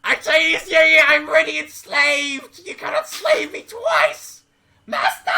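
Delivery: very high, loud screamed protest; shrieking creature. Acted. Enslaved creature refuses a threat of slavery, shrieking.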